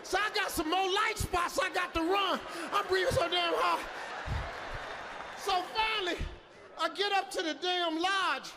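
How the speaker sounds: gasping, terrified after the run; panicked, out of breath. Retold or imitated. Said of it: "I was breathing so hard".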